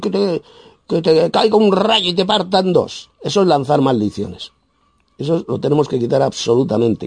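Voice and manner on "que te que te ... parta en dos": high-pitched mock curse; imitated curse. Preacher imitates people cursing someone, high mock voice. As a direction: mimic someone hurling a curse. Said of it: "especialmente gitanos, gitanas".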